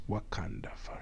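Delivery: whispered Wakanda chant in a bad African accent; very soft, whispery fake African accent; terrible African accent. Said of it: "that was a terrible African accent".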